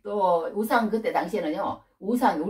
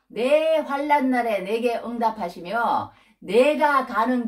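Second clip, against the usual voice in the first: loud, slow, raised declaiming; scripture proclamation; 5 semitones higher, 4 dB louder, slower than usual. Acted. Preacher repeating Jacob's resolve to build an altar, loud slow proclamation. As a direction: proclaim it big, stretching each phrase.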